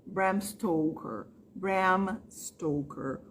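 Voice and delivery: Stoker with a long, clear O, slowly. Shown as a demonstration. Teacher showing students the O in Stoker, slowly. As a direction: drag out the O in Stoker. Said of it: "É um som de O".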